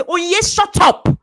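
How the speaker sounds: loud, high self-rebuke; mock self-scolding shout; shouting at yourself. Shown as a demonstration. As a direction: yell it at your own reflection. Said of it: "look at yourself in the mirror and say".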